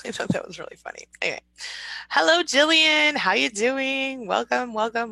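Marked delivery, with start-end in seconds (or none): 2.1-5.1 higher, slow, sung-out hello; drawn-out cheerful hello; excited greeting. Real, not acted. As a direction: greet her like a long-lost friend. Greeting a viewer joyfully.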